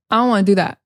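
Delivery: "I don't" without the D, blended into "wanna"; casual reduced "don't", no D; dropped D. Shown as a demonstration. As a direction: run "I don't wanna" together. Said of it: "we drop the D and say".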